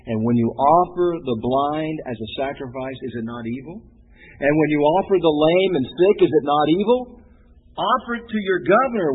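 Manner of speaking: solemn preached reading of Malachi; scripture reading. Acted. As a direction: proclaim the verses with rising weight.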